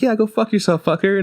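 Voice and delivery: high, cute little alien cussing; cute kid voice. Retold or imitated. Game's alien kid swearing at the man, cute high voice. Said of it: "this really cute voice".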